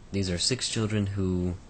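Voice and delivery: ordinary talk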